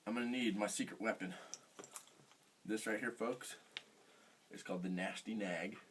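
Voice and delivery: softly, like sharing a secret; hushed. Acted. Unveiling his secret weapon.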